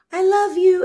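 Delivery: high, loud mock "I love you"; airy love and light. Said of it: "like, the love and light".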